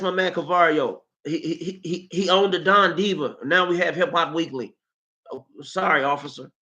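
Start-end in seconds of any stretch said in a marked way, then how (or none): none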